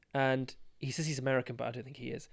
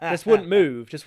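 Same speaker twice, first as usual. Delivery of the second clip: laughing imitation; 3 semitones higher, 8 dB louder, slower than usual. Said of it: "making this face go".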